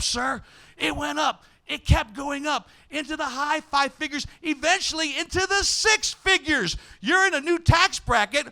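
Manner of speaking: ordinary talk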